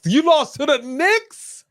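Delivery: high, slow incredulous question; can't believe they lost; disbelief. Real, not acted. Disbelief at team losing.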